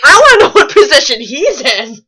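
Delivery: loud laughing question. Real, not acted. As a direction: say it while cracking up. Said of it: "laughing reply".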